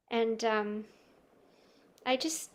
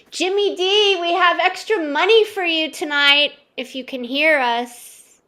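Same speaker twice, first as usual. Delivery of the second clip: loud call through a door; 5 semitones higher, 10 dB louder than usual. Acted. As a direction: shout to someone in another room. Calling out to bathroom.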